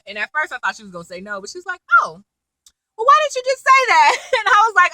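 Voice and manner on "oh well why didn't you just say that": high, loud exclamation, imitated; mock realization. Susan finally getting the coded request, high mock exclamation. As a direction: burst out as if it finally clicks.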